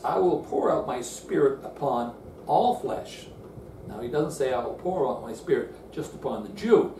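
usual speaking voice

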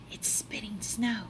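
soft unvoiced whisper. Child whispering at the window about snow. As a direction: hush it like a secret.

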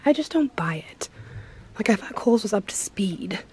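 whispered aside; whispering. Real, not acted. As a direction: whisper it like a secret. Aside at store.

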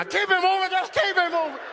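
high-pitched bossy woman guard voice; lady security guard. Acted. New lady guard waving cars on, high bossy voice. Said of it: "it's a lady now".

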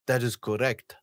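lightly voiced tester confirming the answer; imitating tester. Retelling the show, vlogger lightly imitates the Jedi tester.

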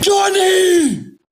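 high excited yell of a name; excited shout. Real, not acted. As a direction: cheer him on at full voice. Streamer cheering on live stream. (grito emocionado)

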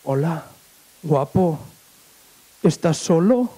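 lightly voiced seductress, low and unhurried; flirting blonde. Blonde sidles up to Beni at a party, flirtatious. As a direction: come on to him with slow, spaced words. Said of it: "se le acercó una rubia".

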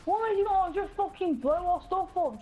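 usual speaking voice

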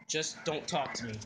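low, soft brush-off; sulky. Acted. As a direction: mumble it, wanting to be left alone. Sulking son rebuffs questions.

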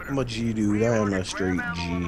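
deep boastful voice. Pretend. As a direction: brag in a put-on low voice. Boastful bit in low voice.

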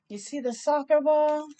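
plain reading voice

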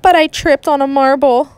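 ordinary delivery

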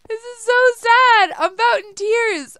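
high, loud hammed-up wail of despair; wailing in pretend tears over a lost house; mock wail. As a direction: overplay the heartbreak, high and loud. Lost house, repeated louder.

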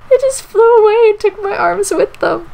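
high, loud tearful whine; hammed-up crying complaint; mock wailing. Mock wailing over lost arms.

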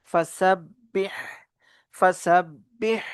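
slow "فسبح" ending on a strong breathy h; "فسبح" with a forced, vowelless h; pressed ha. Shown as a demonstration. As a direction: squeeze the final h of "فسبح" from the throat. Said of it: "হায়ের উচ্চারণ চাপ দিয়ে পড়ব".

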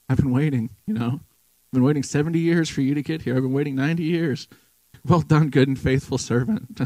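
picture God at heaven's gate, beaming and eager; joyful welcoming Lord. Retold or imitated. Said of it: "He's waiting there".

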